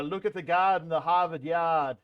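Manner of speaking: loud mock Boston accent; showy put-on Boston accent. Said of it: "I do not have that accent".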